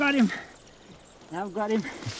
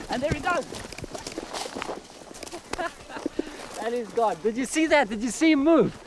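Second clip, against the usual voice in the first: excited; 8 semitones higher, 3 dB quieter than usual. Real, not acted. Lizard bolts from tree.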